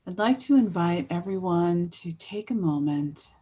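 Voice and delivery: gentle guiding voice with long pauses; guided meditation. Real, not acted. Host inviting everyone to think about the world around them, guided meditation. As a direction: ease listeners in, leaving space after each phrase.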